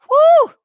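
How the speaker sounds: loud high whoop of joy; excited whoop. Real, not acted. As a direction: let out a joyful whoop. Excited exhale after celebration.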